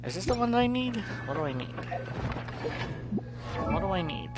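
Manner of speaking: usual speaking voice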